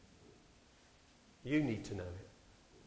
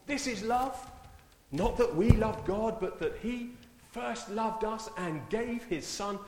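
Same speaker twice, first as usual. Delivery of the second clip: higher and slow; fervent scripture reading; 9 semitones higher, slower than usual. Acted. Preacher reads First John to the congregation, fervent. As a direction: read it out like a proclamation.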